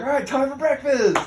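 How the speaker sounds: high, loud mock call; called-out announcement. Hazers summoning recruits to breakfast, loud high call. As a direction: holler it to the whole group.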